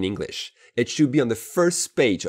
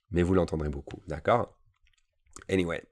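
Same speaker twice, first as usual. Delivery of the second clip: usual speaking voice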